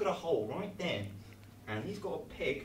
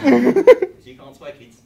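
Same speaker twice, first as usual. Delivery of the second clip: mock warning; 8 semitones higher, 21 dB louder, slower than usual. Real, not acted. Mock scolding kids about swearing.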